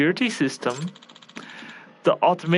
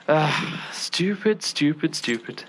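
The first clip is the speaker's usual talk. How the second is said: self-scolding outburst; slower than usual. Acted. Chef cursing himself over the lost recipe, frustrated then fading. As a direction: berate yourself, then trail off. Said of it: "Sorry for the outburst".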